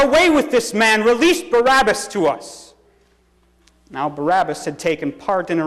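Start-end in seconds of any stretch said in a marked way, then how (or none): 0.0-2.7 raised, high-pitched cry of a crowd; crowd's outcry. A narrator's reading. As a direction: cry out as an angry mob demanding. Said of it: "With one voice they cried out".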